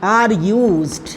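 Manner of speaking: very slow, stretched-out words; drawn-out board-writing voice, dropping at the end; dictating while writing. Real, not acted. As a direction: dictate word by word, pausing as you write. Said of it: "तो यहाँ पर लिखेंगे".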